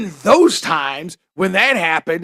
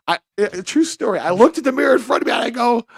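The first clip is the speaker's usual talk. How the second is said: normal talking voice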